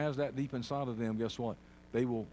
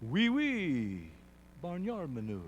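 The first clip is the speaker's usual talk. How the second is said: higher, hammed-up French accent; joke French accent; 4 semitones higher, slower than usual. Preacher joking for the congregation, in a put-on French accent. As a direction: toss out mock French. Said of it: "With a French accent".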